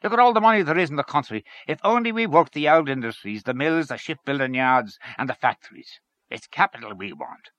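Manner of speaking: lively character dialogue; animated story character. Acted. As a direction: argue it eagerly, pitched above narration. Voices a character's argument in story.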